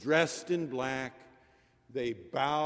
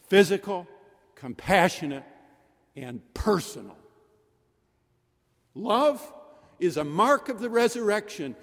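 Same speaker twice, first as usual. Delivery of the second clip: higher and louder, each phrase ringing out; preaching at full force; 6 semitones higher, 7 dB louder than usual. Acted. Preacher proclaiming love to the congregation at full force. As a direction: proclaim it to the back pews.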